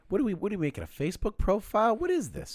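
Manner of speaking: high-pitched, incredulous griping; baffled, voice climbing high; exasperated complaint. Real, not acted. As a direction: protest in rising disbelief. Exasperated by yet another form.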